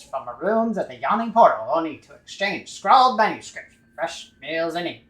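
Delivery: booming newsreel narrator read; old-timey comic narrator. Acted. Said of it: "read in the old-timey comic narrative voice".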